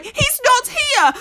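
high, slow, alarmed; realising the friend is gone; worried pig voice. Acted. Pig calling for lost friend.

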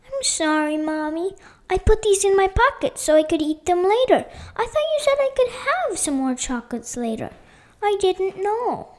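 put-on high little-kid excuse; pleading child. A narrator's reading. Leanna explaining pocketed chocolates to Mommy, pleading. Small child apologising to mother.

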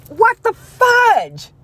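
high, drawn-out disbelief; mock outrage. Patient reacting to surgeon leaving, mock outrage. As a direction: stretch it out high, as if utterly appalled.

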